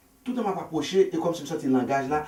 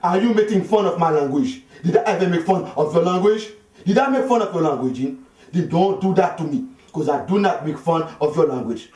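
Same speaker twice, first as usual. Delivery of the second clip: put-on higher, louder indignant African accent; angry scolding; 8 semitones higher, 9 dB louder than usual. African man scolding Dean for mocking his language, loud and high. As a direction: scold him for mocking you. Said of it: "Mi se di".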